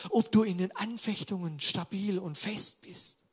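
normal talking voice

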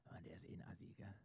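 hushed, voiceless gossip whisper. Acted. The enemy at your table whispering a coworker's insult.

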